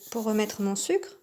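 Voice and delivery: ordinary talk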